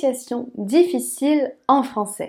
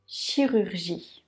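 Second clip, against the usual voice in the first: "Chirurgie" drawn out slowly and softly, every syllable clear; slow careful; 3 semitones lower, 7 dB quieter, slower than usual. Shown as a demonstration. Teacher to learner modelling the word slowly, soft and careful. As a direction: stretch "Chirurgie" slowly and gently. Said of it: "Chi, donc le son ch".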